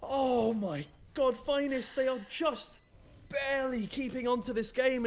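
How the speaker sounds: high, loud cry easing into drawn-out amazement; excited play-by-play. Real, not acted. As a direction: erupt at the winning play, then marvel at it. Live play-by-play climax.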